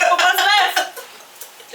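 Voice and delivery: slow, high, giggling repeat; laughing echo. Real, not acted. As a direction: stretch it out through laughter.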